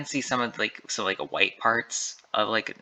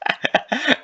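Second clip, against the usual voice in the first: high burst of laughter; 6 dB louder than usual. Real, not acted. Laughs at own accent attempt.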